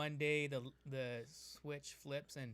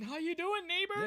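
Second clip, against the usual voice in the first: much higher and louder singsong hello; cheery neighbor greeting; 9 dB louder than usual. Pretend. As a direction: sing it out to the neighbor.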